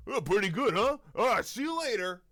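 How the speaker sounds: high, loud and self-satisfied; pleased oddball. Retold or imitated. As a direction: chirp it proudly after a terrible song. Man after screaming song in lot.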